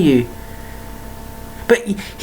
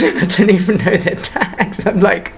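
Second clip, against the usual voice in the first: laughing. Real, not acted. Laughing at himself.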